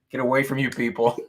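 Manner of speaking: usual speaking voice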